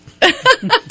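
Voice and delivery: sudden squealing laugh at himself; high burst of laughter. Real, not acted. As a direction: laugh out loud at the slip. Laughs at own accent slip.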